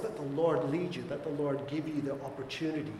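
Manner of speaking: usual speaking voice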